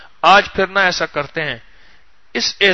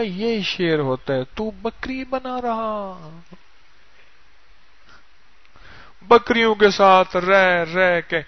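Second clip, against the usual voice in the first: slow, stretched-out words of reproach; drawn-out reproach; 4 dB quieter, slower than usual. Acted. Big lion chides the goat-raised cub, slow drawn-out reproach. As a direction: drag the words out to drive it home.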